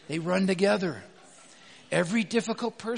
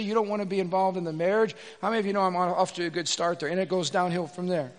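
ordinary talk

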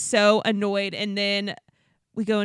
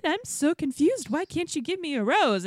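higher-pitched imitation of baffled pleading; mock confused whine; 7 semitones higher than usual. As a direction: play up his confusion, pleading. Voicing the baffled rejected suitor.

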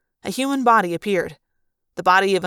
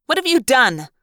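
sharp, louder angry challenge; demanding; 5 semitones higher, 5 dB louder than usual. A narrator's reading. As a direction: demand an answer, raised and hard. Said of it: "one of the Chi demanded".

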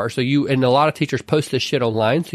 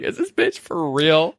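incredulous; 3 semitones higher, slower than usual. Retold or imitated. Professor's inner voice retold.

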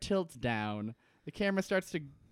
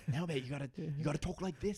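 hushed mock-British aside; 6 dB quieter, faster than usual. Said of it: "like, whispers in".

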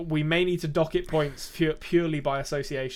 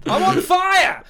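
excited shout; 9 dB louder, much slower than usual. Pretend. Jokey self-praise after a teasing remark.

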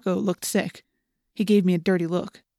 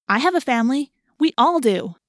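louder, higher, defiant; heated insistence; 6 semitones higher, 5 dB louder than usual. A narrator's reading. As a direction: argue back with force. Rachel argues back.

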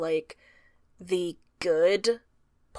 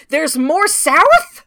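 slow, loud, disbelieving "There's more south?"; incredulous question; 5 semitones higher, 7 dB louder, much slower than usual. Retold or imitated. Reader baffled by the Northwest Shrew tribe, disbelieving. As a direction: draw it out in mock disbelief. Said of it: "and I'm like".

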